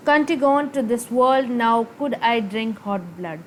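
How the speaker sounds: very slow, word-by-word reading; measured line-by-line recital of a soliloquy; verse recitation. Acted. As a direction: read the verse out deliberately, pausing between phrases. Reads out the soliloquy.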